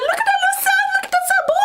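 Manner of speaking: very high-pitched, hammed-up gushing; excited squeal. Feyre showing Rhys their future son, excited high squeal.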